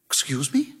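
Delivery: shocked, breathless whisper; taken aback, barely voiced; a startled, hushed excuse me. A narrator's reading. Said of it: "her voice shocked".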